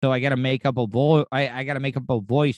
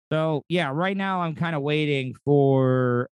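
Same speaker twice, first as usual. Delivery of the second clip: ordinary talk, nothing marked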